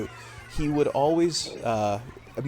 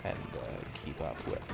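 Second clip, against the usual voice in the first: much lower and softer, a put-on deep voice; 5 semitones lower, 10 dB quieter than usual. Shown as a demonstration. Streamer shows chat the deep stream voice, low and soft. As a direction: drop the voice low and hold it. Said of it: "a deep voice like this".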